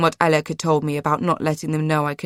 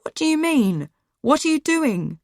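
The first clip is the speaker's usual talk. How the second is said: much higher, alarmed cry; frightened shout; 10 semitones higher than usual. A narrator's reading. Girl demanding what the woman will do, shouting. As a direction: shout out in fear. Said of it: "I started shouting".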